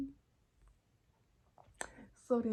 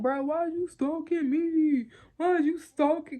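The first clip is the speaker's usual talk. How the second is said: mimicking boyfriend; 7 semitones higher, 8 dB louder than usual. Imitating boyfriend accusing her of stalking, a mock whine. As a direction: throw his accusing question back, hammed up. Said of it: "He said".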